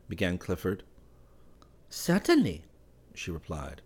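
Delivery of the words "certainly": high, light woman's voice, cool and teasing; young Parisian woman. Acted. Valentine asks Clifford why he is not at Versailles, in a high, teasing woman's voice. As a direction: play her as poised and a little mocking.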